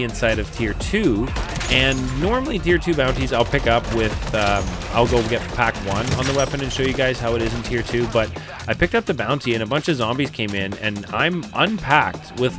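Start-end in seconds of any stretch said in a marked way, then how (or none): none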